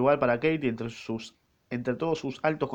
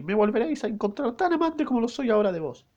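higher falsetto maid, tender farewell; loving maid voice; 11 semitones higher than usual. Acted. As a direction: say goodbye sweetly up high. Maid's farewell to the knight.

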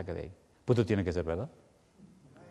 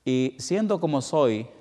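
ordinary talk

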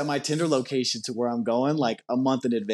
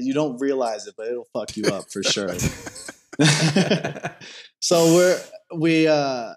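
ordinary talk

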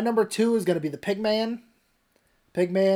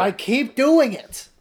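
shouting back; 5 semitones higher, 5 dB louder, much slower than usual. Real, not acted. Defensive yell back.

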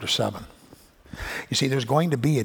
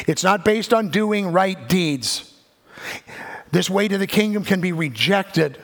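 higher and louder, driving every phrase; preaching at full force; 6 semitones higher, 4 dB louder than usual. Acted. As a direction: preach it out, rising to a peak. Insistent preaching on the kingdom.

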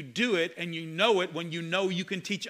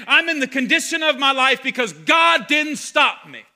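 immature whine; 7 semitones higher, 11 dB louder than usual. Retold or imitated. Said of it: "an immature baby speaking foolishness".

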